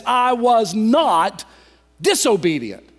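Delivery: "not" drawn out, then a pause before "disobedient"; emphatic affirmation. Retold or imitated. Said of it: "a form of emphatically affirming".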